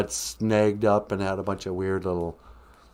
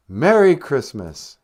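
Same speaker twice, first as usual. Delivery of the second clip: ordinary talk, nothing marked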